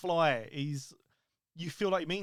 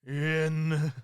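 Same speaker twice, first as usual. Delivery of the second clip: slow, word-by-word doom catchphrase; drawn-out theatrical intoning with long gaps; Undertaker impression; 4 dB louder, much slower than usual. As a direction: draw out each word, swelling on the last. Co-host performs the Undertaker catchphrase.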